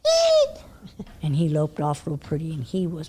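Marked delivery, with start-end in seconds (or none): 0.1-0.6 high squeaky mock horse squeal; a sudden shrill little eek. Imitating the jumpy horse's startled noise, a high squeak. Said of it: "he would go".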